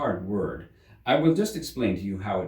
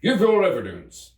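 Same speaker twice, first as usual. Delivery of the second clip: louder, higher impatient demand; angry King; 11 semitones higher, 7 dB louder than usual. Acted. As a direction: snap the order at the witness. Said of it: "the King repeated angrily".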